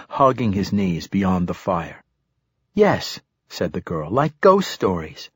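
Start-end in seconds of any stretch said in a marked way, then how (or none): 2.7-3.2 put-on high, eager girlish yes; a keen little girl; young girl voice. Girl answers. 4.1-5.3 lightly voiced young girl; a hint of a keen little girl. Girl answers.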